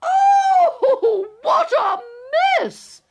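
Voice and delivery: high shrieking cry. Acted. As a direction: shriek in dismay. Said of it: "I shrieked".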